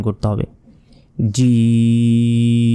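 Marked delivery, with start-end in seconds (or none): 1.3-2.7 জিম with a hard j and very long held ee; sustained "jeeeeem", closing on m; tongue on palate. Shown as a demonstration. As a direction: draw out জিম slowly on one level pitch. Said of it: "তালুর সাথে লাগিয়ে".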